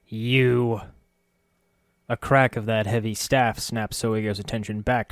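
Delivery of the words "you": louder snarling rodent voice. A narrator's reading. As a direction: snarl like a cornered rat. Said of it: "He snarled, half growl, half chitter".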